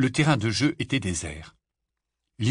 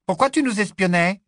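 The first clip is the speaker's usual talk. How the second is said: high, loud accusation; accusing girl; 11 semitones higher, 7 dB louder than usual. Acted. Big sister accuses the boy, high and loud. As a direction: demand an answer.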